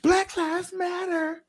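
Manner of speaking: high, drawn-out mock chant; mock protester. Kids protesting in the school hallway, high mock chant. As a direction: squeal out a slogan like a kid protester.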